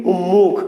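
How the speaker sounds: "উন্মুখ" said um-mukh, doubled m; regional pronunciation. Shown as a demonstration. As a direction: turn the n of "উন্মুখ" into m. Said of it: "অঞ্চলে উচ্চারণ করি কি".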